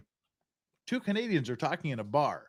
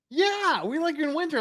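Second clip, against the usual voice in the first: high-pitched, louder imitated enthusiasm; excited retold reaction; 13 semitones higher, 4 dB louder than usual. Friend recognizing a hometown acquaintance, imitated high excitement. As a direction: leap up in pitch as the delighted friend.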